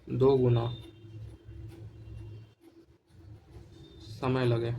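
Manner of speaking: spaced out for note-taking; slow dictation. Shown as a demonstration. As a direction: read it out piece by piece, very slowly. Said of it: "note डाल के लिखिएगा". (धीरे-धीरे note लिखवाना)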